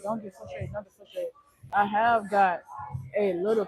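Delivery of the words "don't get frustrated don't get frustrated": quiet self-reminder. Retold or imitated. To himself, echoing his father's advice, softly calm. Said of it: "even when I know my daddy".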